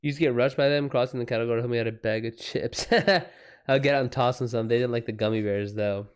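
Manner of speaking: normal talking voice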